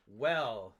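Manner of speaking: loud, slow, deliberate scolding; emphatic disapproval. Pretend. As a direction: lay down the rule word by word. Mock disapproval of dumping a boyfriend.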